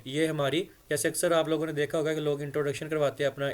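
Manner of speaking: usual speaking voice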